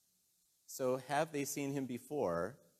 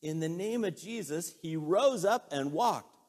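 loud, high proclamation, slowing down; triumphant preaching; 5 semitones higher, 9 dB louder than usual. Real, not acted. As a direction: declare it forcefully, stretching the climax. Preacher climaxes the healing story.

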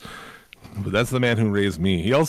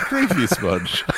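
laughing; 3 semitones higher, slower than usual. Real, not acted. Repeats dad's phrase laughing.

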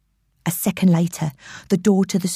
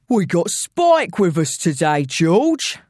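slow, taunting London villain; intruder's voice; slower than usual. Acted. Intruder announcing his henchman to George, taunting villain. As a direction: draw it out as a veiled threat. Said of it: "said the first voice".

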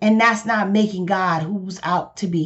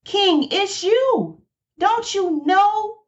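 much higher, slow, pointed accusation; prophet accusing; 10 semitones higher, slower than usual. Retold or imitated. Nathan the prophet confronting the king, higher and slow. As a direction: hammer it home like a prophet unmasking a king. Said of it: "And he said".

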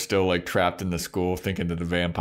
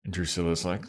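usual speaking voice

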